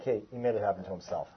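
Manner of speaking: low, quiet shrug of pity; detached pity. Acted. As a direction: murmur it like a resigned onlooker. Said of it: "Pity is like".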